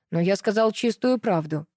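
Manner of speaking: ordinary delivery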